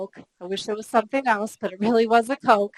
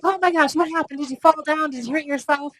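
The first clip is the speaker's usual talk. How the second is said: high, louder, worried questions; alarmed concern; 6 semitones higher, 3 dB louder than usual. Retold or imitated. Husband and roommate finding her in tears by the truck, alarmed. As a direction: sound startled and anxious for her. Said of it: "They're like".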